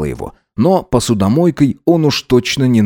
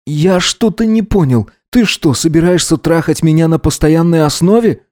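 higher-pitched, lightly voiced disbelief; indignant young student figure; 7 semitones higher than usual. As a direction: question him as if taken aback. Voices Gideon, taken aback. (возмущённо, с недоумением)

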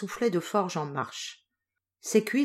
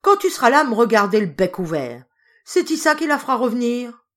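loud, high furious scolding; a furious wife mocking her gaping husband, loud and high; 5 semitones higher, 9 dB louder than usual. A narrator's reading. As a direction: snap at the husband with full fury.

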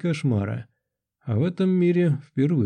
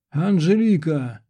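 raised, high-pitched call of a name; desperate cry; 4 semitones higher than usual. A narrator's reading. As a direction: cry out to someone being dragged away. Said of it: "Я издал нечеловеческий вопль". (отчаянный крик)

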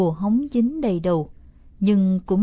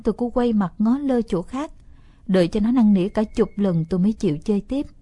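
usual speaking voice

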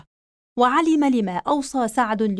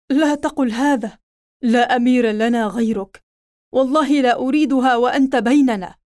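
protesting; 5 dB louder than usual. Acted. Hudhayfa protests loudly.

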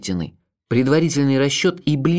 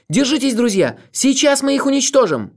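very high, strained rallying cry; rally them at the top of the voice; breathless shout; 13 semitones higher, 4 dB louder than usual. A narrator's reading. Olga rallies her friends under overload, strained shout. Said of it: "крикнула она, задыхаясь".

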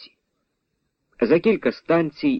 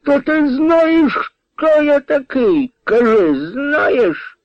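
very high, slow indignant squeal; drunken whining; 4 dB louder, much slower than usual. A narrator's reading. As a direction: squeal with wounded self-importance. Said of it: "заскиглив Дараган".

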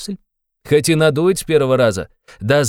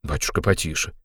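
horrified whisper; 7 semitones lower, 7 dB quieter than usual. A narrator's reading. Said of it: "прошептал в ужасе Порфирий Петрович".

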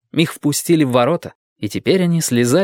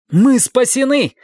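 high, slow cry of relief; joyful exclamation; 12 semitones higher, 3 dB louder, slower than usual. A narrator's reading. Dick crying out at the rescue noise, high and joyful. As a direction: burst out with relief. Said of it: "воскликнул Дик".

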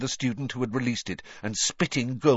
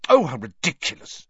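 exasperated; 3 semitones higher, 6 dB louder than usual. A narrator's reading. Hermione exasperated by hate mail.

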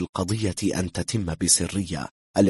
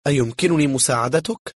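higher, louder, poised question; self-confident woman; 7 semitones higher, 5 dB louder than usual. A narrator's reading. Confident escort greets the intruder, self-assured higher voice. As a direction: ask with bold self-assurance. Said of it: "صورة عن الثقة بالنفس".

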